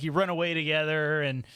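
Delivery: ordinary talk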